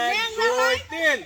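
shouted count to three; loud countdown. Real, not acted. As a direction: call out the count. Counting start of challenge.

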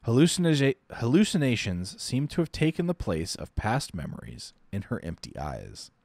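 plain reading voice

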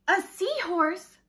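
surprised question. Acted. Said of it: "says Pete".

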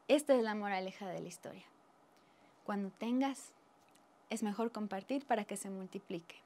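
usual speaking voice

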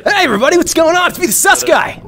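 a cocky comic-book antihero saying hi; Deadpool voice. Acted. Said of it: "I'm doing the Deadpool voice".

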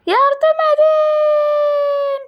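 long drawn-out cry for help; screaming while falling. Pretend. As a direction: call out slowly and loudly. (yavaş, uzun yardım çığlığı)

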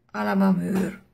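usual speaking voice

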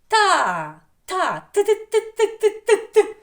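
loud ТА, then high staccato ТИ repeated; syllable demo. Shown as a demonstration. Teacher isolates the second syllable, ТА then rapid-beat ТИ. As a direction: fire off ТИ in short, high, loud bursts. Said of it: "Давайте другий склад".

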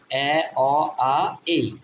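এ, অ, আ, এই as four separate vowels; vowel sounds demo. Shown as a demonstration. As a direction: sound out এ, অ, আ, এই one by one. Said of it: "চার রকম".

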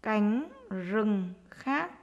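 usual speaking voice

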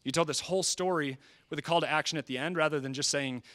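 ordinary talk, nothing marked

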